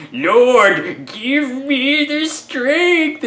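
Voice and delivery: high, drawn-out mock strain; hammed-up constipated plea, stretched out slowly; straining hero. Reviewer mimicking Samson under the pile, high straining cry. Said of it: "giving us his constipation face".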